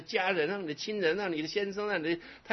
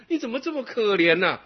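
你怎么这么可怜呐 said louder, stressing 你; louder pity; 8 semitones higher, 7 dB louder than usual. Acted. To the angry man, louder pitying call.

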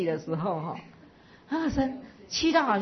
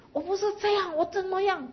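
retold angry outburst; 12 semitones higher than usual. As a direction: pitch jumps way higher, a heated defensive protest. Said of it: "那个声音就拉高，拉高八度". (模仿当年被误解时拔高八度的气愤辩解)

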